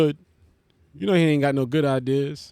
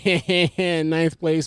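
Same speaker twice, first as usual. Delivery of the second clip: ordinary delivery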